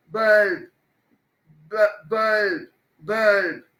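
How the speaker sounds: Bulb leaning on the opening "ब"; stressed bulb. Shown as a demonstration. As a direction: push the first sound of bulb, let it fall. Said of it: "पहले letter को stress करेंगे".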